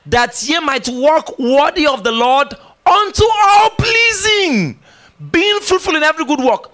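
slow, loud, high shouted scripture; congregational recitation. Shown as a demonstration. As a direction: lead the whole church in reading it aloud. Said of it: "let's do verse ten together".

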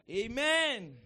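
preacher's booming Amen; loud call. Real, not acted. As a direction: call out to rouse the congregation. Calling for congregation's response.

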